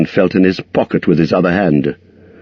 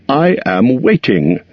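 bold and cheerful; 6 semitones higher than usual. Acted. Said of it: "He tried to sound bold and cheerful".

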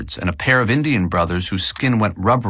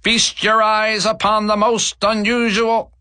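high, slow grand barker call; 12 semitones higher than usual. A narrator's reading. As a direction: draw it out like a showman. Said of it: "The voice grew louder".

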